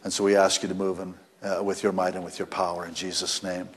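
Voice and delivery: prayer. Real, not acted. Closing a prayer.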